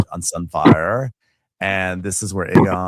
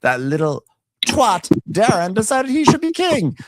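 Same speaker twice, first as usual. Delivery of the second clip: higher-pitched, mounting outrage; put-on fury, climbing in pitch; mock indignant tirade; 7 semitones higher than usual. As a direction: rant like an aggrieved king. Acting out Aegon's angry tirade.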